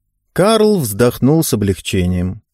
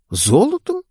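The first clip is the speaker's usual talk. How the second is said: sharp high rising question; doubtful "Золотом?" with a big rise; 13 semitones higher than usual. Acted. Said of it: "с сомнением".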